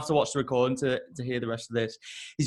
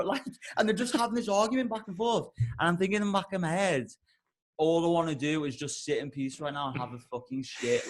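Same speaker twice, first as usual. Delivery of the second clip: ordinary talk, nothing marked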